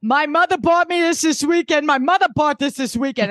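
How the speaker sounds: high, indignant mock protest; Dorinda. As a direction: impersonate an aggrieved hostess. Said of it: "Dorinda's like".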